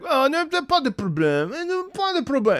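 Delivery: high, easy French reassurance; calm taxi driver. Retold or imitated. Taxi driver shrugging off the crash, high and easy. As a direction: brush it off in French, high and light. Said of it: "my taxi driver was like".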